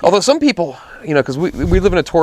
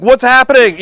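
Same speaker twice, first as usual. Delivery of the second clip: bewildered; 7 semitones higher, 5 dB louder than usual. Retold or imitated. Retelling bewildered reaction to shouting.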